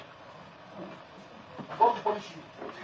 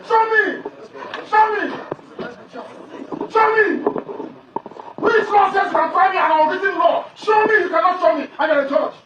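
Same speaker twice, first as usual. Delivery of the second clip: furious shouting; 4 semitones higher than usual. Real, not acted. Defendant yelling at judge to show the law, furious shout. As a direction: demand it again and again, furious.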